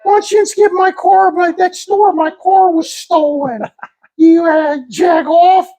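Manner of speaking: high, slow Pittsburgh whine; Pittsburgh accent. Retold or imitated. Neighbor complaining his car was stolen, high Pittsburgh accent. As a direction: exaggerate broad Pittsburghese up high. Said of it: "that's a Pittsburgh accent".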